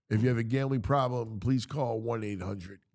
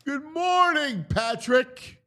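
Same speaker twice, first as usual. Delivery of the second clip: high mock greeting; 14 semitones higher, slower than usual.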